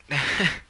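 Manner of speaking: short, loud, high burst of laughter; self-amused laugh. Real, not acted. Laughs at own odd behaviour.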